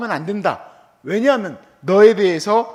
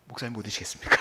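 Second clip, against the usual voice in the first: humble offer; 9 semitones lower, 8 dB quieter, faster than usual. Retold or imitated. Offering coffee to senior pastors.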